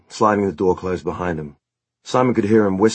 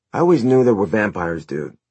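higher, louder dude voice; excitable bandmate Eric; 4 semitones higher, 5 dB louder than usual. A narrator's reading. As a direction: play an enthusiastic garage-band dude. Plays Eric in the story.

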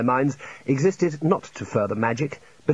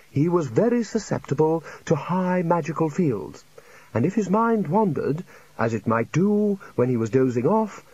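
higher, lingering storyteller with a little chuckle; rambling old academic; 5 semitones higher than usual. Acted. Old academic recalling a colleague's oddities, rambling amusedly. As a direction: savour the anecdote, in no hurry. Chair rambles through his anecdote.

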